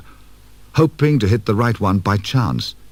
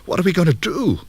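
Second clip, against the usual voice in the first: much higher, anxious plea; panicky; 10 semitones higher than usual. Acted. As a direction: Kate at a loss, voice up high. Kate panicking at the switches.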